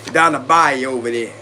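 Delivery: mock Cajun accent. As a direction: lean into a joking Cajun accent. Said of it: "sound like this".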